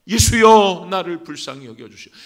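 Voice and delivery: high, very loud call that falls away; crying for mercy. Acted. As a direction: burst out with a plea to Jesus. 믿음으로 부르짖는 시범. (간절하게 터져 나오는 외침)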